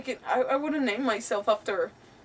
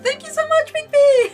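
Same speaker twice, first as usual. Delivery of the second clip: high, squealed thank-you, louder; squeaky over-the-top fan thanks; mock gushing thanks; 8 dB louder than usual. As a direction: gush it in a put-on high voice, breaking into laughter.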